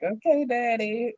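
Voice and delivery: put-on high, little-girl answer to Dad; obedient daughter. Grown daughter answering her protective dad, mock little-girl voice. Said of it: "So I'm like".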